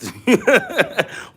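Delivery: high burst of laughter. Real, not acted. Streamer laughs at his back-in-the-days comparison, amused laugh. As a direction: laugh at your own comparison.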